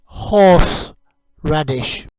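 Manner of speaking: slow, loud "horseradish." drawn out; slow clear teaching model. Shown as a demonstration. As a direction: say "horseradish." slowly and clearly for repetition. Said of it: "You say it".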